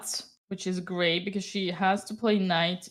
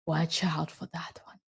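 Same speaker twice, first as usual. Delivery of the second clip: whisper; 7 dB quieter than usual. Retold or imitated. Retold warning to Hammer.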